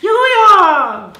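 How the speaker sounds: falsetto shout at full tilt; Mario. Acted. Actor delighting fan's child, full-force falsetto whoop. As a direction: whoop very high and very loud. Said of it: "which he picked up from Mario".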